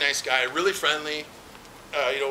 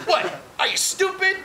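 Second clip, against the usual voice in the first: mock angry snap; 9 semitones higher than usual. Irate caller snapping at the call center agent. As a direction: sneer it at the caller like she's dumb. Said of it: "quite angrily and bitchily".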